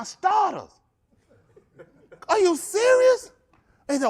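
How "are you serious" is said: high, loud, slow incredulous laugh-question; can't believe what he hears; laughing disbelief. Real, not acted. Coach stunned by player asking for pay, laughing.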